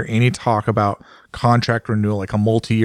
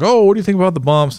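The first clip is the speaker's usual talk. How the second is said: much higher and quick, imitating reporters; mock reporters' question; 9 semitones higher, 4 dB louder, faster than usual. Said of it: "everyone's like".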